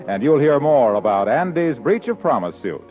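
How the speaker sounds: ordinary delivery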